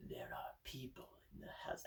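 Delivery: real hushed whisper to the camera; quick voiceless whisper aside. Real, not acted. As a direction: drop to a whisper so the house won't hear. Aside about people at home.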